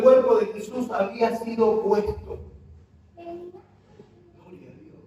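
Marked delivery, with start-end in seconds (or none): 3.2-5.0 much softer, slow and drawn out; hushed praise. Real, not acted. Preacher softly breathing praise mid-sermon, slow and hushed. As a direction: breathe it as worship.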